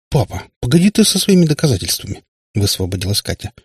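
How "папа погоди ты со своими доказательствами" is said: higher, impatient protest; протест. A narrator's reading. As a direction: cut dad off, exasperated. Katya pulls away, protesting. (нетерпеливо, с досадой)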